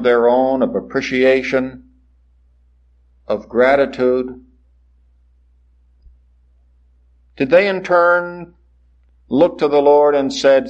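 rising and falling sermon cadence, building in waves; preaching. Acted. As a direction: preach it to the congregation with conviction. Preacher delivers sermon to congregation.

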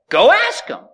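high, loud send-off; emphatic retort. Acted. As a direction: fire it back at the objector. Mock exchange with an objector.

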